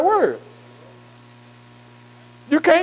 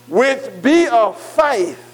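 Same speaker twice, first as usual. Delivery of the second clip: slow scripture reading; much slower than usual. Acted. Reading scripture verse aloud.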